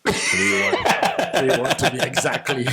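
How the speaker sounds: talking through real laughter; gleeful laughing. Real, not acted. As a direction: laugh as you repeat the gloating score. Gloating over the match score, laughing.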